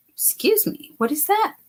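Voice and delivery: high startled excuse me; surprised. Real, not acted. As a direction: react to your own burp. Burp interrupts.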